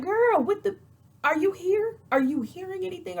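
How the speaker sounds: high-pitched, incredulous questioning; exasperated disbelief. Retold or imitated. Scolds Drew for not listening.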